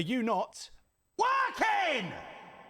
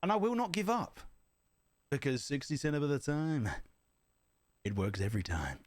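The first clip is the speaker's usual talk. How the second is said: Anchorman quote; 4 semitones lower than usual. Retold or imitated. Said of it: "We try that again".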